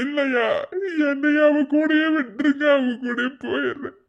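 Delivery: a husband sobbing for his dead wife; anguished crying. Acted. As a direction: howl out the grief. Grieving husband breaks down.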